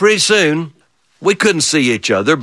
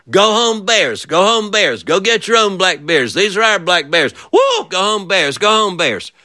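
shooing bears; 8 semitones higher than usual. Retold or imitated. Granddaddy shooing bears away.

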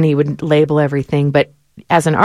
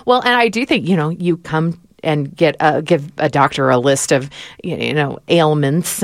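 ordinary talk, nothing marked

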